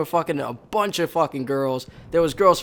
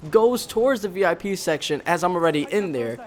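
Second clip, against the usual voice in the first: ordinary talk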